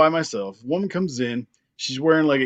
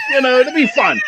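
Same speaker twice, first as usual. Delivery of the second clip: higher, louder, laughing through it; mocking laughing voice; 8 semitones higher, 3 dB louder than usual. Mocking the mother and son robbery outing, laughing. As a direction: play the mom's crazy plan as a laughing joke.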